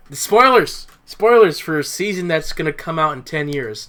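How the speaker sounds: sudden loud, high cry of alarm; mock spoiler alarm. Real, not acted. Podcast host loudly catching herself spoiling a future season, mock alarm. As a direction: blurt it out as if catching your own slip.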